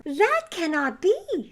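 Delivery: high, slow lamb protest. A narrator's reading. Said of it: "said the lamb".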